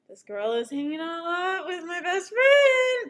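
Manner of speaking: high, loud, rising mock complaint; mock jealous outburst. Retelling jealousy over the best friend, hammed-up outburst. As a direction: build to an exaggerated, high jealous yell. Acting out own jealous thought.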